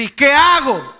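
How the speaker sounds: loud, high-pitched plea for direction; high, helpless outburst; exasperated cry. Acted. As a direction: cry it out as an overwhelmed believer. Acts listener overwhelmed by voices.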